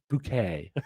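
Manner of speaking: bouquet said "boo-KAY"; posh pronunciation. Shown as a demonstration. Quoting the sitcom, bouquet said "boo-KAY". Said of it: "what did she say".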